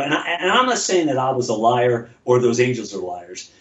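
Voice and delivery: normal talking voice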